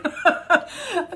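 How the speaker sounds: giggling fit, high and loud; high burst of laughter. Real, not acted. Reader laughing at her own crude words. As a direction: crack up at what you just said.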